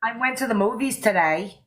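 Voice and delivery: put-on Australian accent, rising ending; American attempting an Australian accent; best Australian accent. Shown as a demonstration. Friend copying the Australian's line, Australian accent. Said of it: "in your best Australian accent then".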